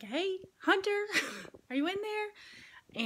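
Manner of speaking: high, carrying call, slowing at the end. Retold or imitated. Mom calling her son through the bathroom door. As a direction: call out into the men's room for him.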